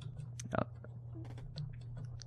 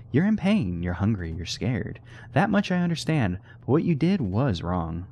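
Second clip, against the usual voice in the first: gentle reassurance; 6 semitones higher than usual. Acted. Player comforting the scared creature, gently. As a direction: talk it down kindly.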